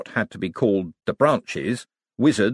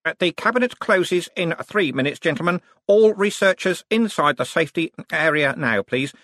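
higher-pitched, projected public warning; megaphone announcement; 8 semitones higher, 3 dB louder than usual. A narrator's reading. Wizard warning researchers the cabinet closes soon, megaphone announcement. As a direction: call it out to the whole room. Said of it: "took a large megaphone from a bench".

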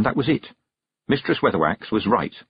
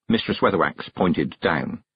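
ordinary delivery

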